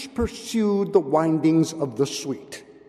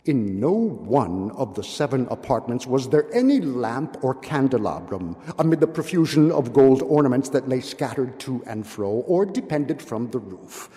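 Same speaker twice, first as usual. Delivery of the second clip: usual speaking voice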